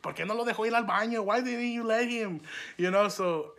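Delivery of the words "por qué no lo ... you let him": higher-pitched imitation of an indignant mom; mock motherly telling-off, pitched up; scolding mom. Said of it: "my mom went up to the school talking shit".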